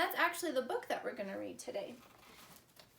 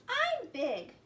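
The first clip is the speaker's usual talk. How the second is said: little sister voice; 8 semitones higher, 6 dB louder than usual. Acted. Little sister speaking in story.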